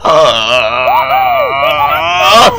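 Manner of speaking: hammed-up yell into a laugh. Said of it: "すっげえ声してんな".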